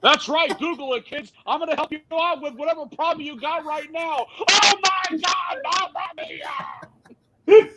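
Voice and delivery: hyped wrestling commentator helping a customer, building to a shout; Mauro Ranallo impression. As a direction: play customer service like an over-excited announcer. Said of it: "I want Mauro Ranallo as a customer service".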